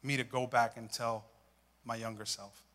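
soft, hushed prayer; quiet prayer. Acted. Asking the Holy Spirit what to tell his younger self, hushed. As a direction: pray it softly, almost inward. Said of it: "I started meditating".

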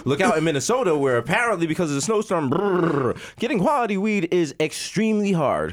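mock weather report delivery; news anchor voice. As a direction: read it like a TV newscaster.

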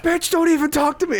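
very high, overdone angry retort; mock yelling. Imitating the prankster snapping at his girlfriend, high mock yell. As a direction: play the boyfriend blowing up at her. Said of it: "yelling at this lady".

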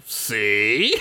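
drawn-out, put-on "see"; hammed-up vindication; mock told-you-so. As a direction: stretch it out, teasing. Voicing the smug brother.

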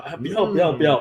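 a touch of put-on shyness, half-hearted; coy little protest, lightly played; mock shy. 他在包厢里对劝酒的女生假装害羞，轻轻推辞. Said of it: "我在那边装害羞".

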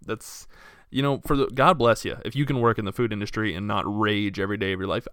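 normal talking voice

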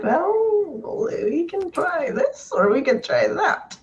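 high, slow coaxing voice; kid presenting options. Retold or imitated. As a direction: gently offer the choices like a kid. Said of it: "Be like".

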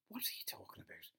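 unvoiced exasperated whisper; whispered aside. Real, not acted. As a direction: breathe it out in disbelief.

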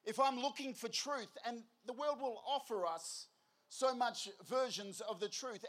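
normal talking voice

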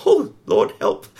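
comic cry of alarm to God; hammed-up "oh Lord, help"; mock panic. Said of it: "we realize".

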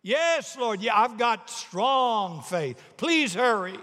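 high, drawn-out, urgent plea; desperate insistence. Acted. Tourist on cliff hurrying the Lord, desperate. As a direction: insist in acted panic, stretching key words.

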